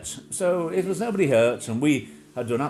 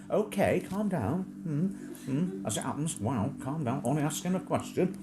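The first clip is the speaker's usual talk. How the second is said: mock soothing; 4 semitones higher, 5 dB quieter than usual. His own soothing reply to crone.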